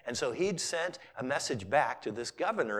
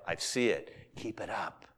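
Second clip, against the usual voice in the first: lower, softer, calm divine reply; God's voice; 7 semitones lower, 4 dB quieter than usual. Retold or imitated. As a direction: speak as God, gently approving. Preacher voices God replying.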